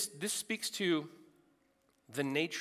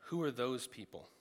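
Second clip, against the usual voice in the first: ordinary talk, nothing marked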